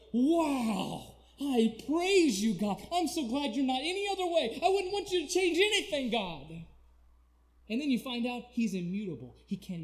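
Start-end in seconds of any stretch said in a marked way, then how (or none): 0.1-6.6 loud, high, exultant cry to God; excited shout of worship, climbing higher; thrilled praise. Acted. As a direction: burst out in amazed praise. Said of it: "that will excite and thrill your life".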